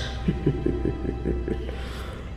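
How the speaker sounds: soft, breathy evil giggle; villainous chuckle. Acted. Queen savoring thoughts of torture, softly sinister chuckle. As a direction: chuckle quietly with cruel relish.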